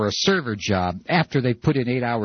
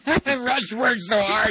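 mocking caller voice; 12 semitones higher, slower than usual.

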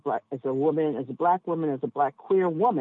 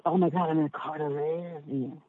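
a light mock of a flustered passenger, a little softer; mock shocked passenger; 4 dB quieter than usual. Cab driver mimics a passenger's shock, softly mocking. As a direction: send up the passenger's startled gasp. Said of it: "it's like".

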